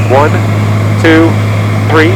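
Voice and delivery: loud count through the iPhone cable; loud intercom recording. Shown as a demonstration. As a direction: count one two three loud, boxy and close. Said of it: "We'll switch over to the iPhone".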